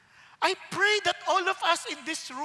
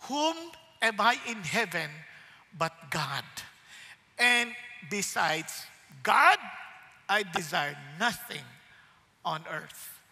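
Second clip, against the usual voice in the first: prayerful; 8 semitones lower, slower than usual. Pretend. Psalm quoted as a prayer.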